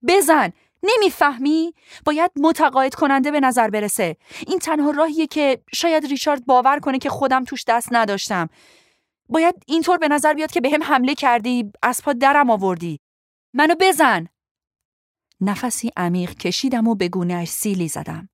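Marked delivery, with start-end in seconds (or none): 0.1-13.0 loud, high-pitched angry urging; heated, raised-voice demand. A narrator's reading. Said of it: "با عصبانیت گفت". 13.5-14.3 high, loud angry order; furious raised-voice demand; angry command. A narrator's reading. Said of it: "با عصبانیت گفت".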